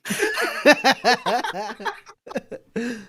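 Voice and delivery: high, helpless laughing fit; burst of laughter. Acted. Roland cracks up under the pressure, bursting out laughing. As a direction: burst out laughing and keep going. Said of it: "Roland bursts out laughing".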